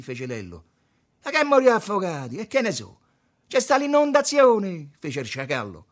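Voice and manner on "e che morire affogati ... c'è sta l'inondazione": high, shouted retort; loud retort. A narrator's reading. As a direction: throw it back loudly. Lo sciacallo risponde concitato. (replica gridata)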